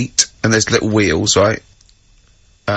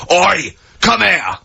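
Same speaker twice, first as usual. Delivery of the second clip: mock shout; 5 semitones higher, much slower than usual. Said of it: "You can go".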